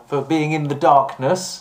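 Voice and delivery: ordinary talk, nothing marked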